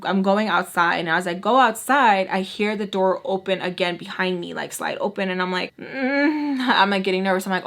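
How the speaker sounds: usual speaking voice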